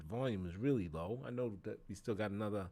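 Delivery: ordinary talk, nothing marked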